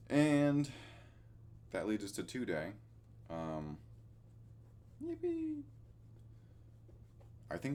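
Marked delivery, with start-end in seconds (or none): 5.0-5.6 a much higher, thin mock cheer; sarcastic yippee. Real, not acted. Vlogger reaching a dull day, mock cheerful yippee. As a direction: fake enthusiasm, clearly ironic.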